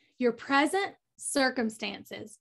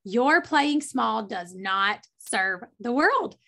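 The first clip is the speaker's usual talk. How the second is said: slow, emphatic; 2 semitones lower, slower than usual. Shown as a demonstration. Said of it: "write this down".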